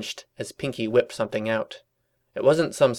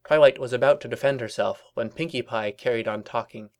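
ordinary delivery

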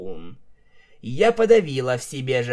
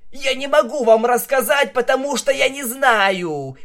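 high, loud, fast desperate shout; shouting back; 11 semitones higher, 6 dB louder than usual. A narrator's reading. Stallion shouting back at Vinyl under interrogation, high desperate shout. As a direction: yell it back in a panic. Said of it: "крикнул в ответ жеребец".

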